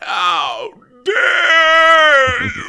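high, loud, drawn-out mock screech; outraged shriek. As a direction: shriek it like a scandalized diva. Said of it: "the gates of hell open up".

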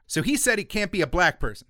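ordinary talk, nothing marked

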